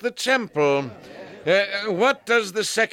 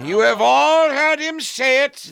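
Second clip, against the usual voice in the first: indignant raised voice; 5 semitones higher, 4 dB louder than usual. Acted. Priest rallies council against Jesus.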